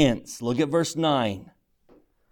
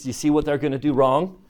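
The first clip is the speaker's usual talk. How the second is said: normal talking voice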